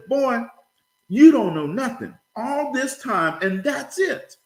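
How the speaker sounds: very high, slow comic exasperation; scolding grandfather. Acted. Grandfather tells boy he learned nothing, high-pitched scolding. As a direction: dress the boy down in mock outrage.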